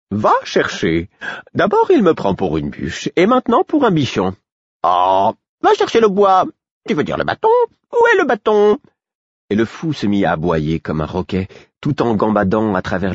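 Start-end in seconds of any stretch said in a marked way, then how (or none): 0.1-8.8 indignant jester, leaping high; clownish, over-excited lapdog act; mocking fool. Fool playing fetch to mock Murfess, clownish.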